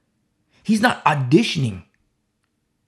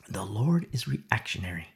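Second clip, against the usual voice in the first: low unvoiced whisper; 8 semitones lower, 6 dB quieter than usual. Real, not acted. Preacher whispering a key point to the congregation. Whispered then repeated aloud.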